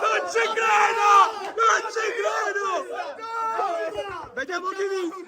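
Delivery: high, thrilled shouting; excited disbelief. Real, not acted. Players await a photo finish, high excited shouts. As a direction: cry out high as the finish nears.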